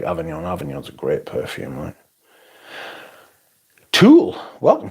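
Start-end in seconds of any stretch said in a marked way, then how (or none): 3.9-4.9 loud greeting. Real, not acted. Greeting viewer loudly.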